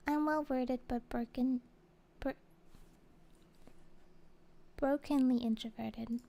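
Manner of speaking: ordinary delivery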